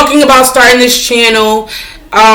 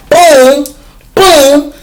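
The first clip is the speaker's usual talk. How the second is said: emphatic boom; 4 semitones higher than usual. Real, not acted. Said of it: "emphatic punctuation".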